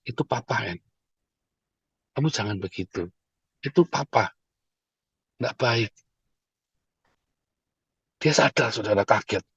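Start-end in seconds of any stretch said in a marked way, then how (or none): 0.1-5.9 very soft, low reassurance; hushed, tender parent voice; gentle fatherly correction. Retold or imitated. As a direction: quietly own up to a child while correcting them. Father hugs and gently corrects child.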